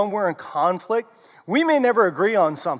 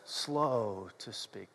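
much softer, low, drawn out; slow and hushed; 10 semitones lower, 16 dB quieter, much slower than usual. Shown as a demonstration. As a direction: hush it and stretch every word. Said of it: "would you repeat this after me".

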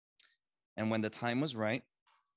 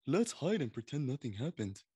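ostrich voice; 4 semitones higher than usual. A narrator's reading. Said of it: "The ostrich".

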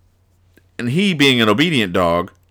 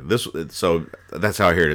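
ordinary talk, nothing marked

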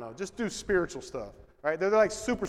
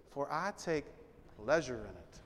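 soft reading; 6 semitones lower, 12 dB quieter than usual. Acted. Preacher reading the psalm verse to the congregation, hushed. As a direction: lower and much softer. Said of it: "Look at this".

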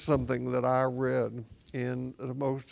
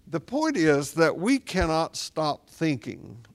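normal talking voice

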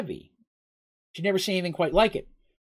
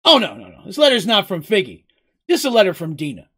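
loud, lively character voice, raised a little; emphatic correction; 2 semitones higher, 7 dB louder than usual. A narrator's reading. Mugen corrects Seraph about the letter, emphatically. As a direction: jump in to set them right.

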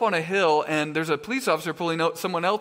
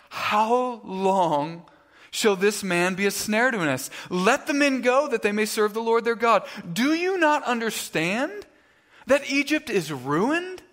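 higher, dramatic scripture quote, slowing on the last questions; exasperated plea; 5 semitones higher, slower than usual. Acted. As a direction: act out servants pleading with their ruler. Servants' mutinous plea read aloud.

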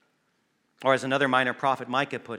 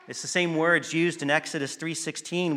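ordinary talk, nothing marked

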